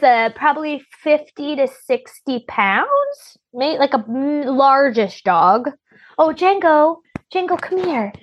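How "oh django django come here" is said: high sing-song call to the dog. Real, not acted. Owner calls her dog over to say hi, in a high coaxing voice.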